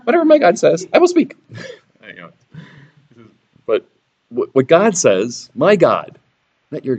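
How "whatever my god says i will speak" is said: high, thin and a bit louder; mousy prophet vowing in a squeak; squeaky high voice. Shown as a demonstration. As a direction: squeak it out timidly. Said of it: "He could have had a voice like".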